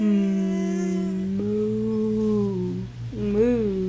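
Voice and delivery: low, drawn-out moo; low, softened cow sound for a child. Acted. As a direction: imitate a cow, deep and long. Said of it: "what does the cow say".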